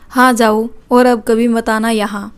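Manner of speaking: ordinary delivery, nothing marked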